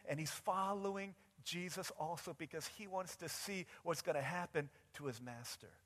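normal talking voice

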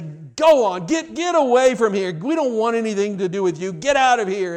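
loud angry shove-off; cursing drunk. Acted. Drunk man cursing at the preacher to go away, loud and angry. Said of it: "He began to curse and say".

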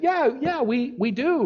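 cornered reply. Retold or imitated. Pastor pressed by a billionaire, flustered and high. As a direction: answer like someone caught out, voice leaping up. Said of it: "backed into a corner".

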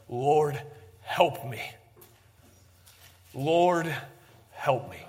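hushed, drawn-out plea with long pauses; a touch of desperation, sinking at the end; understated desperate cry. Acted. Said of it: "hear the desperation in this cry".

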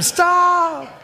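imitating the old lady's shriek; screaming stop. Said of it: "She'd scream".

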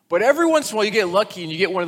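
an excited storyteller's burst; animated. Real, not acted. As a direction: throw the opening line out with energy. Building up a story.

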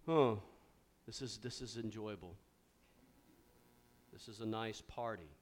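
flat, soft and low, utterly unimpressed; mock a Jesus who finds the party merely pleasant; deadpan bored.

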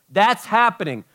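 normal talking voice

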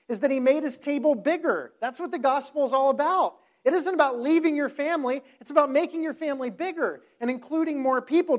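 fervent pulpit emphasis; preaching. Acted. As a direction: drive the sermon point home. Preaching the point at full force.

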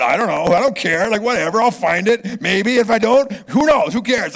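loud, high-pitched dismissive retort; exasperated brush-off. Retold or imitated. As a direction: brush off the nagging, loudly. Acts his unbothered reply to family.

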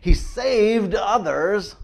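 loud, drawn-out sneer, pitched high. A narrator's reading. Preacher voicing rulers who sneer at Jesus, loud sneering. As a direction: jeer it out slowly at the man. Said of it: "they were even sneering at him".

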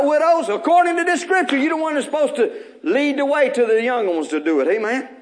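high, loud exhortation to the widows; rally the widows with a fiery call; preaching at full force. Real, not acted.

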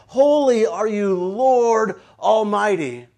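loud, very slow declaration; forceful, drawn-out acclamation; proclaiming praise. Acted. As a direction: stretch out each word in bold praise. Preacher proclaims the angels' praise.

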